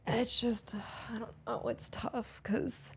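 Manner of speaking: whisper. Real, not acted. Daughter confides about her mom, whispering. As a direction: barely breathe the words out.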